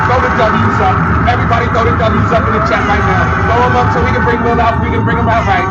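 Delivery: loud rallying call; hype host call-out. Real, not acted. Host urging the chat to welcome Will in a loud call-out. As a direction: rouse the chat.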